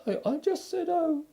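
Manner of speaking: very high, stammering little voice; nervous. A narrator's reading. Piglet covering up to Eeyore, nervous. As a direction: shrink back and stammer it. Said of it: "said Piglet nervously".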